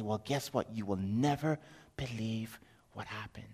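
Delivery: low and soft, fading to near whisper; hushed suspense. Acted. Father telling his family the news, hushed suspense. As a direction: draw them in with a hushed secret.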